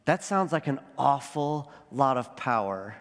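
low, slow line with "awful" drawn out and weighted; stress on awful. Real, not acted. Preacher stressing how much power the church held, weight on "awful". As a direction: lean slowly on "awful", holding it out. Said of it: "I do emphasize the word".

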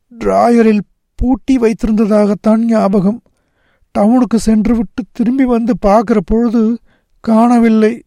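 high, plaintive account; upset boy. A narrator's reading. Nagarajan explains to his friend how it vanished, plaintively. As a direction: helplessly explain the loss in a high voice.

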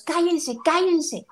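high, impatient hush at the dogs; lady hushing dogs. Retold or imitated. A woman on a walk telling her dogs to shut up, high and impatient. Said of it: "escucho como una señora le dice a sus perritos".

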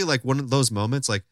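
ordinary talk, nothing marked

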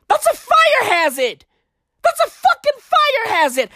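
high-pitched indignant outburst; indignant protest. Acted. Ranting about girls burning stuff, high indignant voice. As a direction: object in high, scandalized alarm.

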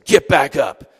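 loud, stern order; mock stern Jesus ordering the fallen; stern command. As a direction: bark it at someone on the ground. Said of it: "and say".

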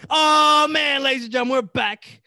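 loud hype podcast intro; hype host intro. Acted. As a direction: shout the show open. Podcast host opens the show.

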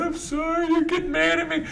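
high, soft whiny sob imitation; mock crying. Learner driver apologizing after being scolded, mock crying. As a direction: mimic a tearful apology in a small high voice. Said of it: "And she's crying".

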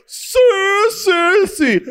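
very high squealed mimicry; high, exaggerated impression of a comedian; imitating a comedian's catchphrase. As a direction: squeal it like her signature bit. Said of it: "cuando tú haces así".

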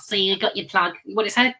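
high cheeky puppet voice; little teddy teasing his handler. Acted.

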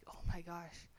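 soft unvoiced gasp of disbelief; whispered disbelief. Alone in the car, stunned whisper. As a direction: breathe it to yourself. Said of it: "I'm like".

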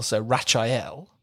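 "Rachael" as "RA-shy-el" in three syllables; Rachel like Raphael. Shown as a demonstration. Host demoing the swap, Rachel like Raphael. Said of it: "pronounced like Raphael".

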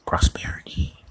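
slow, breathy whispered word. Acted. Dreaming of wealth to himself.

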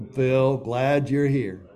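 slow, chanted greeting; drawn-out welcome like a room answering together; slow group reply. Retold or imitated. Sinners at the meeting greeting Phil, slow chanted reply.

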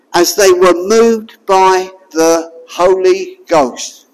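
slow, solemn reading of the verse; slow solemn scripture reading. Shown as a demonstration. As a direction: read it out weightily, word by word. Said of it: "I'll repeat that".

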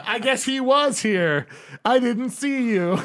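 high, slow, exaggerated realisation; hammed-up sing-out; mock surprise. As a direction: draw it out as a put-on discovery. Acted excuse, hammed up for laughs.

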